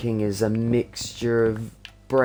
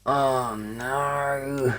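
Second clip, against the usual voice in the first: higher, long drawn-out lament; wailing dismay; 5 semitones higher than usual. Real, not acted. Streamer seeing the job will take ages, wailing dismay. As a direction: stretch it into a playful wail. More dismay at slow building task.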